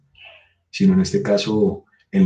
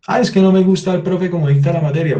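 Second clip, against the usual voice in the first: a student's whiny complaint, much higher; mock student complaint; 9 semitones higher, 4 dB louder than usual. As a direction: whine like a student griping about the teacher. Student complaining about the teacher. (queja burlona de alumno, con voz mucho más aguda)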